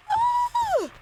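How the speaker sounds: sudden shrill shriek; high startled scream. Real, not acted. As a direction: scream out in fright.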